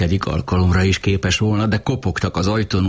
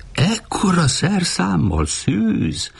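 high, slow, drawn-out outrage without shouting; indignant exclamation; 12 semitones higher, much slower than usual. A narrator's reading. Woman challenging Uri's claim, indignant high-pitched exclamation. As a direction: stretch it out high in scandalised disbelief. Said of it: "kiáltotta a simogató felháborodva".

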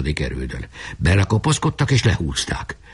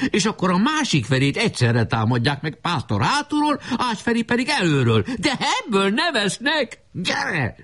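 put-on high-pitched boy giving battle orders; excited boy commander; 13 semitones higher than usual. A narrator's reading. Boy orders comrades to attack front and back, high and excited. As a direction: call the plan out high and urgent.

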